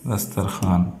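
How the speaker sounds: दस्तरखान said softly as "dastar-khaan"; soft दस्तरखान with no w after kh; silent waw. Shown as a demonstration. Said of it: "वाव की आवाज़ नहीं आती है".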